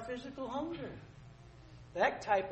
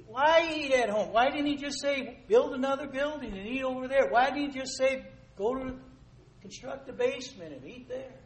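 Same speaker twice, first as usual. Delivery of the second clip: emphatic rhetorical questions; 5 semitones higher, 5 dB louder than usual. Real, not acted. Preacher's rhetorical challenge, raised.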